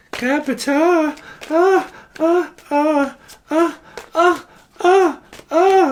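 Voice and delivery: very high, repeated "ah" cries; high sung ahs. Shown as a demonstration. Reader voicing what they hear, high wailed ahs. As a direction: call it out high, note after note. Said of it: "That's what I'm hearing".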